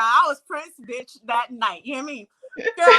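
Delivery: ordinary talk, nothing marked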